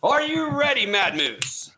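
high, slow, drawn-out announcement; game show host. Acted. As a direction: announce the title big. Host asks contestant.

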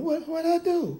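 playfully baffled and put-upon; mock bewilderment. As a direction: plead innocence in a high comic voice. Voices bewildered, persecuted David.

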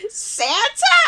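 imitating Fran Drescher. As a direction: call it out as a comic impression. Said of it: "looks at it and just goes".

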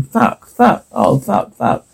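hushed, breath-light panicked cursing; panicked muttering. Acted. Character alone among the birds, panicked muttering.

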